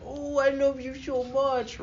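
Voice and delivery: high, slow mock baby talk; cringy lovey-dovey couple. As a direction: imitate a sickeningly smitten lover, high and drawn out. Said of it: "that cringy couple that's like".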